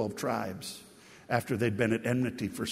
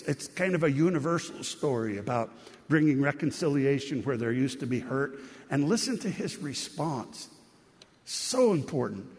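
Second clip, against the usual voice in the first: ordinary talk, nothing marked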